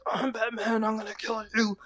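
lightly put-on low-voice superhero; a hint of a menacing vigilante voice; Batman. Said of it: "he's speaking in his low voice".

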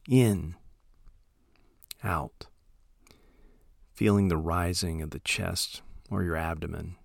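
soft, unhurried meditation voice with long pauses; calm, hushed instruction, letting each phrase trail into silence; guided meditation. Acted. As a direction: gently guide listeners into settling, phrase by phrase.

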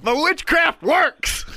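high, drawn-out shout; triumphant crowing. Real, not acted. Co-host boasting of hiccup cure, triumphant shout. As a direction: boast it loudly, stretching it out.

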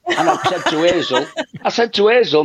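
laughing through the words, pitch up; giggling retelling to the host. Real, not acted. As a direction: chuckle as you tell it.

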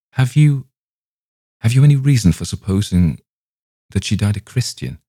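high-pitched, halting question, broken and restarted; start, break off, then ask falteringly. A narrator's reading. Young man trembling as he asks the rabbi, faltering. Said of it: "I faltered".

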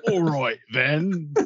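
slow, lightly put-on voice of a co-host; Phil McKenzie impression. Co-host jokingly playing Phil, slow mock voice. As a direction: drawl it out as your co-host. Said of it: "You do such a wonderful Phil McKenzie impression".

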